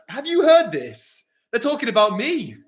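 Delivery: high, slow excited shout; shouting to roommates. Retold or imitated. Shouting to his roommates about being mentioned, high and slow. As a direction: call out to the roommates in disbelief. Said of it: "I shout to my roommates".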